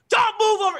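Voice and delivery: very high, loud frantic command; acted exasperated parent shout; retold yelling. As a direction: yell orders in a panic. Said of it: "I'm yelling out".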